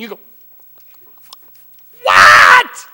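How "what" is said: loud, low shocked shout; dad hearing a curse word from his kid; shocked What. Retold or imitated. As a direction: blurt a stunned What. Parent shocked by swear word.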